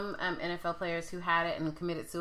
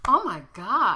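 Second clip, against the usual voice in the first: loud gasp, higher "oh my God"; shocked gasp; 4 semitones higher, 6 dB louder than usual. Real, not acted. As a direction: blurt out in alarm. Reacting to man jumping through glass.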